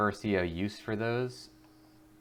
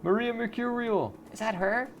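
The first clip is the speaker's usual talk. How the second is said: much higher starstruck fan squeal. Acted. Fan spots the rock star beside her, high squeal. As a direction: gasp it out like a fan seeing her idol.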